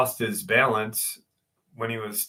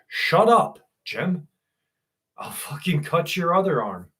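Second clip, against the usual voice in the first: exasperated scolding; 4 semitones higher than usual. Retold or imitated. Retold scolding of embarrassing dad.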